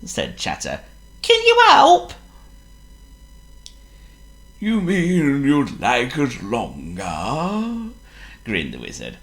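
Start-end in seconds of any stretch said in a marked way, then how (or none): 1.2-2.1 very high squeaky monkey plea; begging the wizard for help; high pleading monkey; Chatter pleading. Acted. Voices Chatter. 4.6-7.9 slow, sly grinning wizard; lightly voiced slow teasing wizard.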